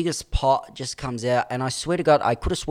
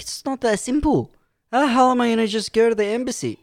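high-pitched retold exasperation; exasperated protest; 12 semitones higher, 4 dB louder than usual. Acts out exasperated protest to sergeant.

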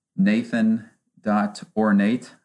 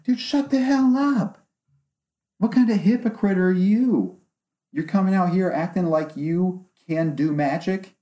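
outraged retort; 8 semitones higher than usual. Retold or imitated. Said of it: "I'm like".

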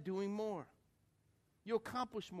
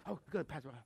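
tired churchgoer; 3 semitones lower than usual. Retold or imitated. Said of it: "'cause you go".

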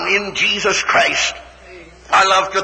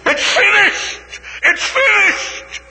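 crying shout; 13 semitones higher, slower than usual. Acted. The preacher at his climax, shouting through tears.

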